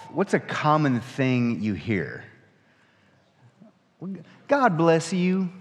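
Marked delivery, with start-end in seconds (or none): 4.5-5.5 slow, higher drawn-out Southern "God bless you"; Southern greeting. Retold or imitated. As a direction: imitate a friendly Southern blessing.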